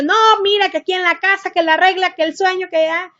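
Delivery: mock lecturing her son about bedtime; a hint of a strict mother listing house rules; firm mother laying down rules. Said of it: "Yo le, te digo, pude haber dicho".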